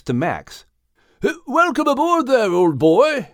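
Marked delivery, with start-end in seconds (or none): 1.5-3.3 put-on high, hearty old-boy welcome; delighted exclamation. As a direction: hail a friend aboard, pitched way up. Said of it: "exclaimed Craddock".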